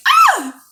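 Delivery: short high-pitched squeal; giddy squeal. Acted. Woman thrilled about her date, giddy squeal. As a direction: let out a little excited yelp.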